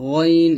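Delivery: গইন with a thick, heavy throat sound; thick ghain. Shown as a demonstration. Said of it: "মোটা করে উচ্চারণ করতে হবে".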